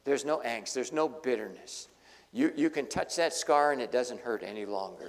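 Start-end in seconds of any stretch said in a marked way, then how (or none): none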